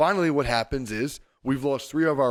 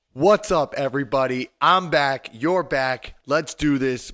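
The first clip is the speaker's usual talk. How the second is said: loud, punchy welcome back; hyped show opener; 6 dB louder than usual. Real, not acted. Host greeting listeners at the top of the show, hyped.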